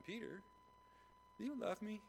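high-pitched acted question; gentle repeated question. Acting Jesus asking a second time.

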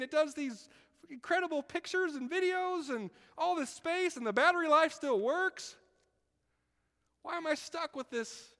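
high, loud, whining envy; mock jealous squeal over a phone; envious whiner. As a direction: squeal about a friend's amazing phone.

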